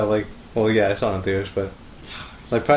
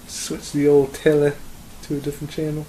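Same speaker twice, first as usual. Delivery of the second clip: put-on British accent; 3 semitones higher than usual. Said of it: "was that you British".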